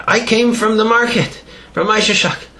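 high, eager simple voice; lightly voiced simple man; excited villager. Exhausted man voiced in story.

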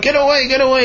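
high, hurried shout; shooing yell. Acted. Baker chasing the dog away, yelling. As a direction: shoo the dog off.

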